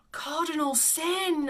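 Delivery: loud, high, drawn-out mock outrage; mock horror. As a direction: exclaim slowly as if scandalised by your own slip. Mock horror at own slip.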